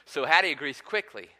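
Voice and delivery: normal talking voice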